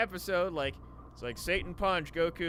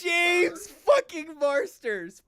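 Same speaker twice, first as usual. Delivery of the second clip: loud, drawn-out, higher excited shout; 10 semitones higher, 7 dB louder, much slower than usual. Real, not acted. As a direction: belt the name out in delight. Excitedly naming the actor.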